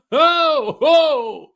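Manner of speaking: high, loud drawn-out oh; shocked oh. Real, not acted. Host absorbing the divorce news, shocked. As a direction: let the news land on you. Reacting to chat's prenup news.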